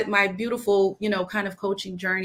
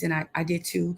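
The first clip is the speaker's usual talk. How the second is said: normal talking voice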